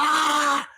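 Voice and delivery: very high, loud raging yell; war cry. Retold or imitated. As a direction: charge in screaming like a wild animal. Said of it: "I was like".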